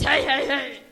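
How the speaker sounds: loud jolly "hey, hey, hey"; mock Santa laugh. Bear insisting Santa says hey, loud and jolly. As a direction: insist on it, boldly. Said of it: "No, I think it's".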